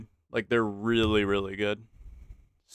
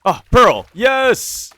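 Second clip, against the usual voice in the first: high, loud cry of joy; excited; 12 semitones higher, 13 dB louder, much slower than usual. Real, not acted. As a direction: shout with delight at the find. Finding Pearl at last.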